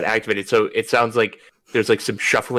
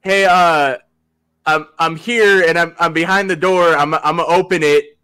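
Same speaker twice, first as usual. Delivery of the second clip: raised, higher voice, hesitant announcement; retold awkward heads-up, projected; calling through a door; 6 semitones higher, 6 dB louder than usual. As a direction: call out to someone on the other side.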